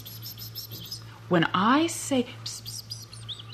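soft unvoiced psst sounds; hissing pss. Shown as a demonstration. Boots showing the old woman the signal, soft hissing. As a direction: hiss the pss sounds very softly. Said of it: "stand ... and pss".

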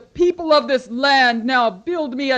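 loud commanding goddess rage; furious goddess. Acted. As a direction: thunder at the foolish mortals. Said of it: "the goddess was angry".

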